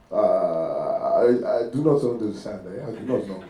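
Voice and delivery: put-on slow, halting African-accented English. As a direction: play the baffled foreigner with an African accent. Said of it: "switch from these accents".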